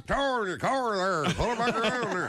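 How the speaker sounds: put-on North Georgia accent. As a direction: lean hard on every R, North Georgia style. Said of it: "everything got a R in it".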